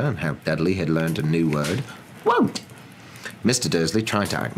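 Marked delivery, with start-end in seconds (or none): 2.2-2.6 put-on very high-pitched little-boy refusal; bratty toddler. Dudley showing off his new word, childish squeal. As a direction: squeal it like a stubborn small child.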